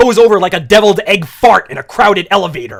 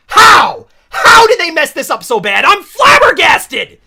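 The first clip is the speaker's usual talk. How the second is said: louder, higher disbelieving cry; outraged shout; 7 semitones higher, 7 dB louder than usual. Real, not acted. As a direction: sputter in loud disbelief.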